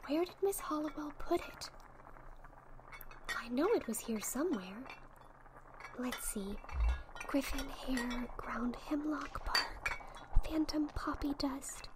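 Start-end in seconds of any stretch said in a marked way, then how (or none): 3.7-11.9 hushed whisper while rummaging the shelf; whispering to herself. Acted. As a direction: whisper the labels to yourself. Searching the shelf, muttering.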